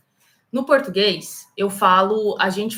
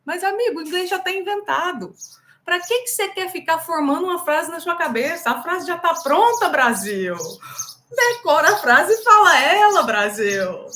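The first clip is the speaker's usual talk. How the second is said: higher, louder scolding of the class; exasperated scolding; 6 semitones higher, 4 dB louder than usual. Real, not acted. Teacher scolding Brazilian learners, higher and louder. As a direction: rouse them with a scolding.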